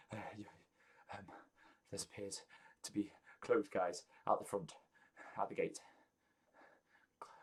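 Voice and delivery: soft, halting, stammering report; very nervous helper. Acted. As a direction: stammer out the news softly, with anxious gaps. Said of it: "very nervous".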